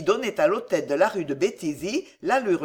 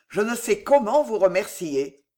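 ordinary delivery, nothing marked

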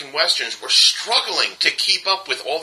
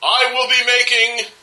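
pompous announcement; 7 semitones higher, much slower than usual. Retold or imitated. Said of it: "Tarantino ... said".

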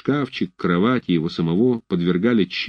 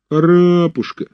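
begging whine; 9 semitones higher, 4 dB louder than usual. A narrator's reading. Fedchenko keeps begging.

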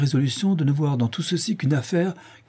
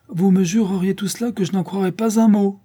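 marquise; 10 semitones higher, 4 dB louder than usual. A narrator's reading. Marquise flatly refuses.

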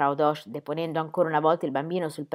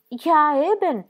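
higher, louder eager echo; eager agreement; 9 semitones higher, 7 dB louder, much slower than usual. A narrator's reading. Midwife echoing the husband with eager agreement. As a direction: chime in warmly to agree. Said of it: "gli fece eco Frau Dosch con partecipazione".

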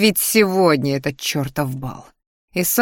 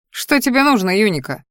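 ordinary delivery, nothing marked

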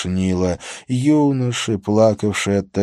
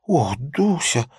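coy female voice; 7 semitones higher, slower than usual. Acted. Prostitute greets officer in story.

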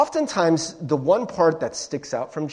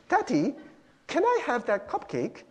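put-on high, soft British little-kid voice; British child; 8 semitones higher, 5 dB quieter than usual. Child asking a parent for a treat, high British kid voice. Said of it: "I don't know why he has to be British".

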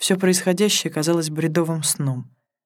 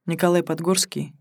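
plain reading voice

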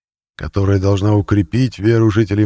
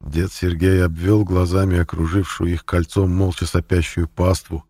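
ordinary delivery

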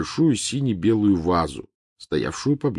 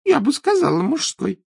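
Trelawney voice; 6 dB louder than usual. Acted.

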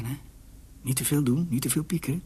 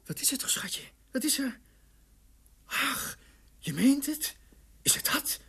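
anxious high coaxing. Acted. Coaxing wife in labour.

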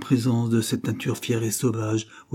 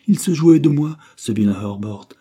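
loud, raised, agitated outburst; 6 semitones higher, 9 dB louder than usual. A narrator's reading. Jilted woman accusing Herbert to William, agitated. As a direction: burst out loudly in agitation. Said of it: "reprit-elle avec agitation".

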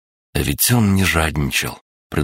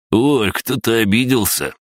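sing-song jeer at a sulking man; насмешливо протянул; 7 semitones higher than usual. A narrator's reading. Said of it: "насмешливо протянул Олег". (насмешливо, нараспев)

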